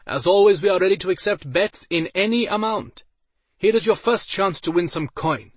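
loud, high betting call; arena announcer. A narrator's reading. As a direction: hawk bets to the crowd. Announcer calls the odds.

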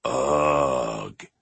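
low caveman grunt; Neanderthal grunt. Retold or imitated. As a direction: grunt like a primitive man. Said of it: "do guys instinctively go".

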